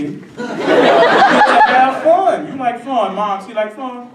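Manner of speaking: high, loud drawn-out hype; hype man. Retold or imitated. Coaches sell fun to Mom, high hyped-up voice. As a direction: stretch "fun" into a mock holler. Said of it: "more of the hype man".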